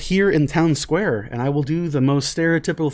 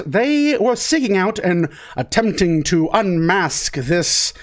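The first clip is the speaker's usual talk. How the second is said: raised theatrical villain pomp; grand villain voice; 5 semitones higher than usual. Acted. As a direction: proclaim it like a gloating radio villain.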